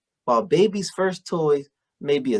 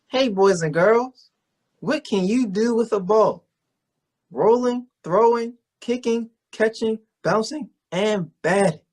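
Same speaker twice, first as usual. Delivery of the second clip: put-on overbright kids party host; 6 semitones higher than usual. [name] addresses kids on show.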